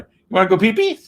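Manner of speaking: put-on high, sing-song baby talk to a dog. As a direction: coo at the dog like a pet owner. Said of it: "we go to the dogs".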